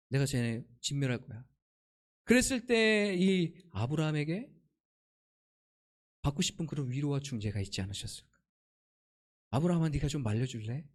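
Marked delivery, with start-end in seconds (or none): 0.1-1.4 low, understated divine pronouncement; a hint of God confiding a decision; lightly voiced God. God tells Abraham of coming destruction, low and understated. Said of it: "뭐라고 말씀하세요?". 9.5-10.8 low, gentle request; lightly voiced God. God asks Abraham to intervene, low and understated. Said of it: "이런 마음이 있지 않았을까?".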